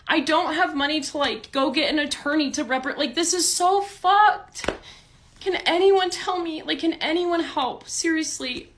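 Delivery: begging anyone for help, voice up high; desperate pleading. Real, not acted. Pleading viewers for legal help.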